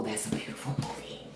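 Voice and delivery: soft, breathy whisper. Real, not acted. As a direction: whisper it like a secret. Tender aside about the film.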